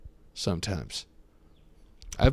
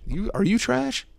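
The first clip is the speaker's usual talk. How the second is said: high, pushy sales pitch; infomercial voice; 12 semitones higher than usual. Pretend. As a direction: pitch it like a TV ad host. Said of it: "sounds like an infomercial".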